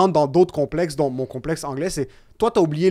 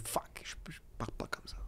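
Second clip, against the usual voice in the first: barely voiced whisper to yourself; whispered inner thought; 3 semitones lower, 24 dB quieter, faster than usual. Talking to herself about others' better French, whispered self-doubt. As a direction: whisper it as a private aside. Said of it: "puis je me dis".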